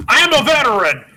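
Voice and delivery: high, loud mock outrage; indignant outburst. [name] squaring up to the husband, outraged. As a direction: declare it in hammed-up indignation. Acted retort in phone quarrel.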